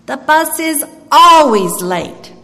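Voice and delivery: put-on old lady repeating. A narrator's reading. Old lady on the bench tells the pastor again, slowly and loudly. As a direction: say it again, drawn out, loud. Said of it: "she said, again".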